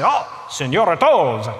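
lightly put-on Italian accent, slow and welcoming; a hint of an Italian accent, drawn out; mock Italian host. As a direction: greet the little princess like an Italian waiter, Italian accent. Italian restaurant staff greeting kids.

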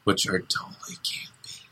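whispered aside. Real, not acted. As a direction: breathe it as a secret.

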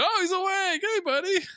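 high, squeaky mock voice of the son; imitating the son. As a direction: chirp it way up like a sweet young boy. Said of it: "He's like".

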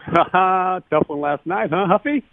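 imitating a teammate's high teasing laugh. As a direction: rib him with a laugh in a high voice. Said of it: "he just starts laughing".

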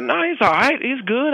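high, breezy dismissal; breezy brush-off. Retold or imitated. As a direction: wave it off like nothing's wrong. Friend brushing it off.

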